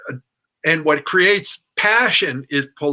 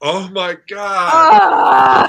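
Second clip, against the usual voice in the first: much louder, very slow, almost crying out; overwhelmed cry; 6 semitones higher, 7 dB louder, much slower than usual. Real, not acted. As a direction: cry out as if melting. Overwhelmed reaction to magic words.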